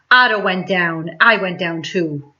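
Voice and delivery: ordinary delivery, nothing marked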